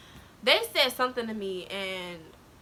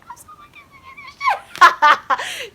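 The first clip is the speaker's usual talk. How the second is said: very high, slow, loud wail; hammed-up sobbing squeal with laughter; mock crying; 15 dB louder, slower than usual. Student cries to second teacher, mock wailing. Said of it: "I'm sitting there crying".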